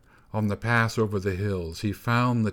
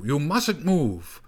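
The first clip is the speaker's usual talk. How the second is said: slow, higher-pitched warning; raised, emphatic command; calling out; 5 semitones higher, slower than usual. A narrator's reading. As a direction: call the order across the wind. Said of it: "cried the snow boy".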